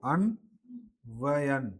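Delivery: अन्वयन stretched out slowly, each syllable held; careful slow अन्वयन; slow and drawn out. Shown as a demonstration. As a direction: draw out अन्वयन for the class. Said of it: "अन्वयन नहीं".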